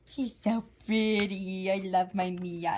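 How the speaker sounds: slow, drawn-out adoring words; getting emotional. Real, not acted. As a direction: gush as if touched by a beloved doll. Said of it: "I'm getting emotional for no reason".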